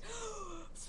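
sharp delighted intake of breath; excited gasp. Real, not acted. Fan gasping at the band's name, sharp excited gasp.

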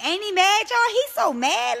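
high, loud teasing to the audience; gleeful taunting. Real, not acted. Teasing the man to live viewers.

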